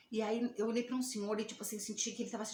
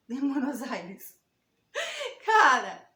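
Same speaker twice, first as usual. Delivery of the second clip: laughing through tears; 8 dB louder, slower than usual. Real, not acted. Moved telling the gift of lodging.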